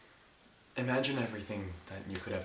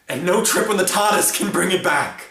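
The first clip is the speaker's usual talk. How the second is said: high, very loud burst of grief-stricken rage; full-voiced angry yell; anguished shout; 10 semitones higher, 18 dB louder than usual. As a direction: cry out in furious loss.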